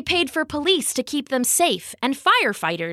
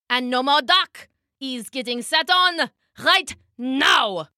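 enraged tyrant, drawing out the final threat; theatrical royal shout, slowing for menace; shrieking king; 3 semitones higher than usual. Acted. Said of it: "he shrieked".

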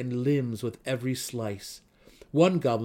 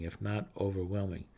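ordinary delivery

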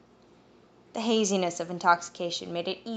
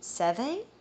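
questioning; 6 semitones higher than usual. Acted. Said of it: "Malia questioned".

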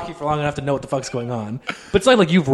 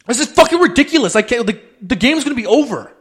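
higher, mock exasperated outburst; imitated angry player; 8 semitones higher, 4 dB louder than usual. Girl complains to the ref about stoppages, mock exasperation. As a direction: mimic a player losing patience. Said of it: "they were just getting so mad".

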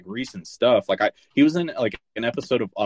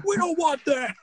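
imitated screaming. Imitating Sinbad screaming in the mall, high mock scream. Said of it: "like, screaming".

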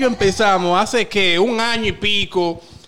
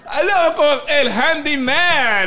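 announcer intro; 4 semitones higher, much slower than usual. Acted. Introducing cast announcer style.

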